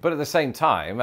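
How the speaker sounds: ordinary delivery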